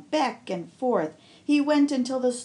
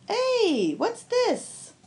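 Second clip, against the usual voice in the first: high, startled call; a fisherman spots something odd in the net; sudden high exclamation; surprised fisherman; 12 semitones higher, much slower than usual. Acted. Fisherman finds the toy.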